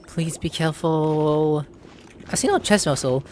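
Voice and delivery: normal talking voice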